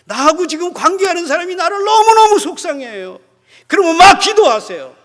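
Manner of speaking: loud, high impassioned sermon; fiery preaching. Acted. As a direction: preach it out at full force. Preaching at full force.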